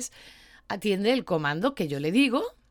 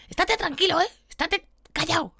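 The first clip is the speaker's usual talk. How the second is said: higher, sharp mock yell at the dog; mock scolding yell; 10 semitones higher, 3 dB louder than usual. Owner yelling at scared dog at vet, mock angry yell. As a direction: bark the order like an impatient owner. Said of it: "le gritan para que se calme".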